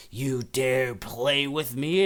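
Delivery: slow, drawn-out mock haunting voice; menacing possessing spirit. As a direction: play a ghost threatening down the phone. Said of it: "possesses the phone and says".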